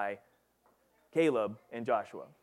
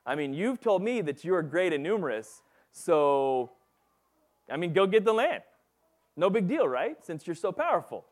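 sarcastic; 5 semitones higher, 4 dB louder than usual. Retold or imitated. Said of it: "you should hear a bit of sarcasm in Joshua's voice".

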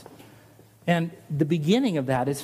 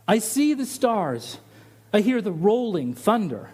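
slow, higher, reverent recitation of the hymn; recited hymn; 3 semitones higher than usual. Shown as a demonstration. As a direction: declaim the hymn lines with awe. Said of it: "I love the beginning of that line".

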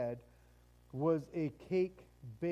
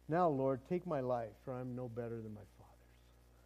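despairing; 6 semitones lower, 3 dB quieter than usual. Acted. Elijah's despairing prayer voiced.

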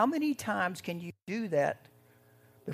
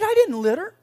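much higher and louder protest; indignant denial; 14 semitones higher, 13 dB louder than usual. Retold or imitated. Driver denying the littering to the trooper, high and loud. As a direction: deny it with outrage. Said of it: "He said".

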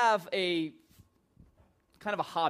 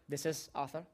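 posing as dad; 4 semitones lower, 9 dB quieter than usual. Retold or imitated.

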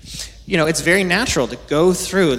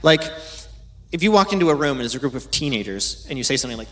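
ordinary delivery

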